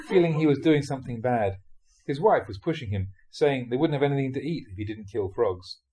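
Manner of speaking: ordinary talk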